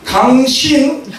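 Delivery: high-pitched acted challenge; angry quarrel. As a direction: call out the other driver in mock anger. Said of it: "이제 화가 났죠".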